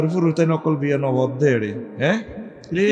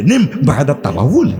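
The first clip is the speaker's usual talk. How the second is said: imitated Arabic translation voice with swooping pitch; translation-app voice; 4 semitones lower, 4 dB louder than usual. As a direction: mimic the app reading out Arabic, pitch jumping. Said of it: "এবার মহিলজ্ঞ করতে আছে". (অনুবাদ অ্যাপের আরবি উচ্চারণের নকল)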